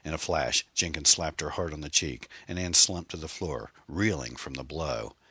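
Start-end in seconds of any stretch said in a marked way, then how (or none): none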